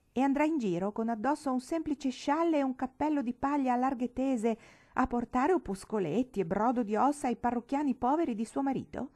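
high-pitched, appalled questioning; incredulità sgomenta. Acted. Sorella incredula, domanda incalzante. (domanda incredula e acuta)

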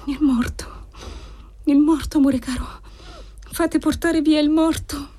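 put-on high-pitched, haunted voice of a girl talking to herself; delirious murmuring. A narrator's reading. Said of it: "mormorando sempre fra sé".

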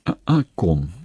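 ordinary delivery, nothing marked

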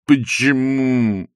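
slow mooing lament, rising in pitch; bovine passion Lokhankin. A narrator's reading. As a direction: draw it out like a lovesick cow. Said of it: "сказал Лоханкин с коровьей страстностью". (протяжно, с коровьей страстностью)

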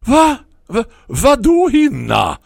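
baffled call back from the window. Acted. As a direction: shout back confused, slowly. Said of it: "ropade jag tillbaka".

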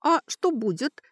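high, innocent question; naive, high-pitched elf girl. A narrator's reading. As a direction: ask it in wide-eyed innocence. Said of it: "наивно спросила Кейтри".

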